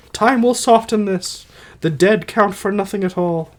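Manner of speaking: high put-on wife's voice; Alcestis voice. Dying wife consoling her husband, high put-on voice. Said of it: "I'll try to do voices".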